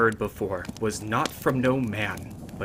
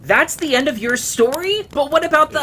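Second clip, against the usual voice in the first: much higher, loud whiny protest; indignant listener; 13 dB louder than usual. Acted. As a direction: protest the ending like an impatient kid. Listener demands more story.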